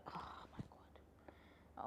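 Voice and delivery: slow, breathless whisper of craving; longing whisper. Real, not acted. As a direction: whisper it like you can almost taste it. Longing for crushed ice.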